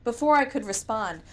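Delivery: ordinary delivery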